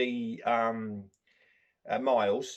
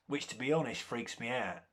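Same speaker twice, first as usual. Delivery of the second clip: usual speaking voice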